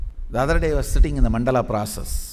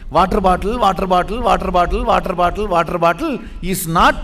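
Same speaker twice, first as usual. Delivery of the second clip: louder and louder, hammering each "water"; emphatic shout; 4 semitones higher, 8 dB louder than usual. Real, not acted. Guru preaching to disciples that the bottle is not water, loud. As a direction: drive it home, building to a shout.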